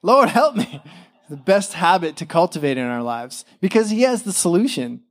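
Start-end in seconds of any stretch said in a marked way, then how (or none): 0.0-0.8 much higher plea to God; sudden high prayerful cry; desperate cry for help. Acted. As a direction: cry out to the Lord in need. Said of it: "to be like".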